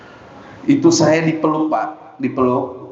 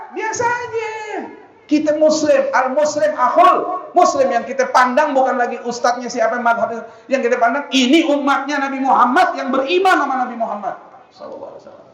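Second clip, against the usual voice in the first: fiery preaching; 9 semitones higher than usual. Acted. Preacher shouting to the congregation about unity, in fiery preaching. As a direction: thunder it from the pulpit.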